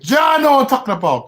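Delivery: loud, high call to John; excited shout. Real, not acted. Streamer hyped up, shouting to John. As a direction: call it out all fired up.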